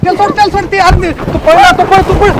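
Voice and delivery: high-pitched urgent cries; urgent calling out. Acted. Villager urging another to run after her, urgent high calling. Acted chase, someone has escaped.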